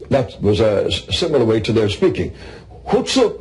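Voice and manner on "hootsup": "Hootsup" repeated high and falling; northern English "Hootsup". Shown as a demonstration. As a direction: demonstrate the Yorkshire "Hootsup" again, pitched up. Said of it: "means, uh, "What ails you?".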